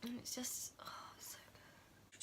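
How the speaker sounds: breathless whisper of awe. Real, not acted. Overcome by the song, whispering to viewers. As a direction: barely breathe it out.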